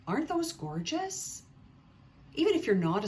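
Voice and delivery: high, rising gasp of admiration on "those"; gushing delight. Real, not acted. Podcaster showing viewers gorgeous socks, high delighted rise. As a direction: swoon over the pretty socks. Showing off sock pattern photos.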